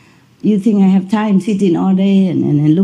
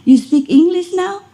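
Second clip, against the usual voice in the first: much higher astonished question; amazed question; 11 semitones higher than usual. Retold or imitated. Owner asking her dog in delighted disbelief. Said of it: "Oh my God, I said".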